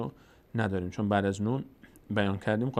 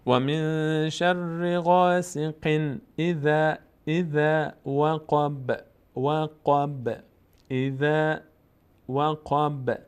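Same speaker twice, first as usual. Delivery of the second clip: وقب with a bouncing ب; tajweed chant; slow recitation; 3 semitones higher, much slower than usual. Shown as a demonstration. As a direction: chant slowly with qalqala.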